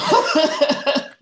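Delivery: high laugh choking on tears; emotional laugh. Real, not acted. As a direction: laugh through welling emotion. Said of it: "I'm feeling emotional".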